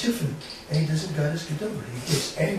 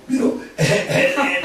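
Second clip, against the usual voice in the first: ordinary talk, nothing marked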